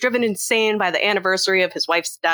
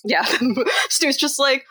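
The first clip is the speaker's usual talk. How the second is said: usual speaking voice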